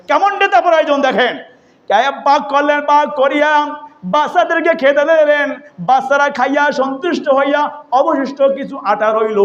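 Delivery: high sing-song sermon delivery; melodic preaching. Retold or imitated. Preacher showing listeners a true leader, melodic preaching. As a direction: preach it high and chanted to the congregation.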